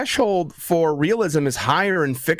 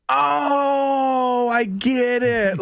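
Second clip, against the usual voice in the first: retold realization; 7 semitones higher, much slower than usual. Realizing the name mix-up.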